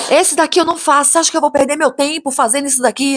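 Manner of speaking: high-pitched, indignant builder rant; acting out an outraged refusal; retold angry yelling. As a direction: mimic a worker ranting at a client. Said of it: "por que que você tá gritando comigo".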